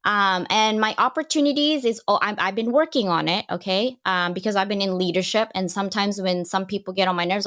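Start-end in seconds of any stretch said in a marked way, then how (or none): none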